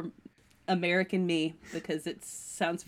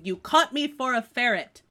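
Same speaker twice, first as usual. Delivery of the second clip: retold shout; 5 semitones higher, 6 dB louder than usual. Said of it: "grabbed him by the throat while shouting".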